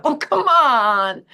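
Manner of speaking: high, loud and slow, mock exasperated; a drawn-out teasing oh come on; exasperated disbelief. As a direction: drag it out in playful disbelief. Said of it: "I go".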